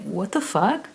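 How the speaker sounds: hushed, baffled disbelief; soft, bewildered mutter; stunned parents. Retold or imitated. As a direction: say it quietly, taken aback. Parents stunned by her sobbing.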